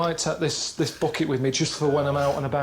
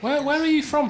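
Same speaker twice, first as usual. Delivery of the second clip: puzzled question; 12 semitones higher than usual. Real, not acted. Puzzled by the man's accent.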